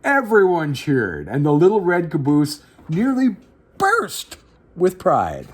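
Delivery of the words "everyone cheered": ordinary delivery, nothing marked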